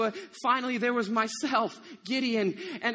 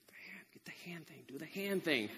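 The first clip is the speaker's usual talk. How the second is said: urgent secret prompt, very soft; whisper; 6 semitones lower, 11 dB quieter than usual. Acted. Gideon secretly coaching his men at the spring, whispering. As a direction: sneak them a hushed hint. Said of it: "to not whisper to the men".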